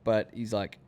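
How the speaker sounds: usual speaking voice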